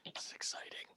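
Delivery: whispered aside. Real, not acted. GM aside while dice roll.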